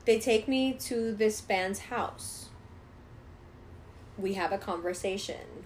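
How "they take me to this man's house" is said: usual speaking voice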